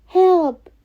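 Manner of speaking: loud high shout for help; cry for help. Acted. Cat stops the carriage, loud high cry for help. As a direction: yell for help. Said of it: "he cried".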